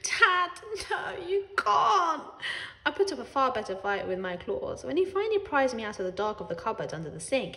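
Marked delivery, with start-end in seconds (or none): 0.0-2.2 put-on high, slow pleading cry of a girl; horrified plea. A narrator's reading. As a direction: beg Dad desperately. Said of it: "Ellie was even more horrified".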